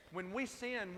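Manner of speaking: ordinary talk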